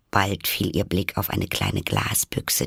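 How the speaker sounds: plain reading voice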